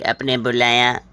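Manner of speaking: ordinary talk, nothing marked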